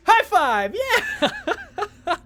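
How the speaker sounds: loud, high cheering; high-pitched shouts breaking into laughter; gleeful celebration. Real, not acted. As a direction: whoop for a high five. Celebrates a shared bad joke.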